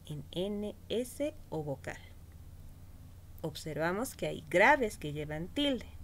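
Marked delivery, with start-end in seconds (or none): none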